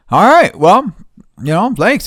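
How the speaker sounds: high, loud, chirpy fake-friendly thanks; mock clerk voice. Mocking the clerk who tries to send him off, chirpy and high. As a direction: play the clerk as sugary and clueless.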